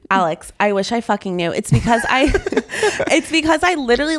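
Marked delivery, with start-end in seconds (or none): none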